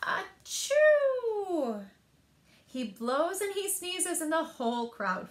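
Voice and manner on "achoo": loud, high, explosive sneeze; big bear sneeze. Acted. Bear sneezes awake from a pepper fleck, loud sneeze. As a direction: blast out a huge sneeze. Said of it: "makes the bear".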